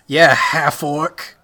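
slow loud emphatic yell; loud drawn out. Acted. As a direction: belt it out slowly. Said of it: "Whoa, calm down".

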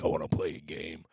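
very low, menacing killer voice; Jigsaw. Acted. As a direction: drop deep into a horror-villain impression. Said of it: "It was all jigsaw style".

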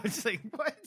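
high squeaky confusion; mock confused what. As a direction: play it baffled and high.

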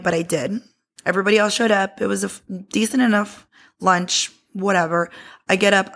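usual speaking voice

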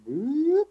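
high squeaky boop; a cartoonish little boop; boop sound effect. Glasses sliding down sound.

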